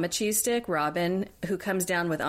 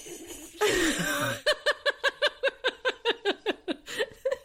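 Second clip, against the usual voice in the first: high laugh. Real, not acted. Laugh after language joke.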